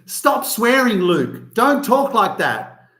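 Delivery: high, scolding parent voice, slow on the last line. Retold or imitated. Parents telling their son to stop swearing, scolding. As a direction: tell him off like a strict parent. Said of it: "They weren't saying".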